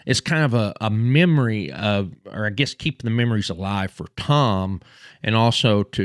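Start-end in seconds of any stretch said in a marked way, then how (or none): none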